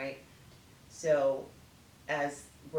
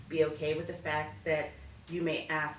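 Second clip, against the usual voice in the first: ordinary delivery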